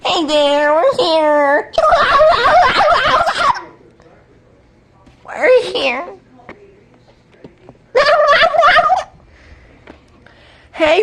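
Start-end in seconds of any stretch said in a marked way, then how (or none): 1.8-3.6 high giggle. Acted. Little brothers arrive laughing. 7.9-9.0 high giggle. Acted. Little brothers arrive laughing.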